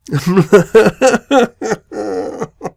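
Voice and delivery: high, prolonged cackling laugh; helpless laughter. Real, not acted. Reader losing it over armoire, helpless high laughter. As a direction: dissolve into giggles. Tongue twister breaks the reader.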